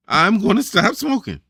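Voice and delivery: loud, high-pitched, forceful declaration; straight and emphatic. Shown as a demonstration. Coach shows a smoker the affirmation, loud and emphatic. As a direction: declare it straight out, like you mean it. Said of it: "Straight like that".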